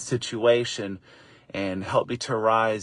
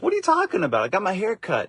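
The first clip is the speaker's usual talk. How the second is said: surprised retort; 9 semitones higher, faster than usual. Retold or imitated. Retorting to kids about haircut.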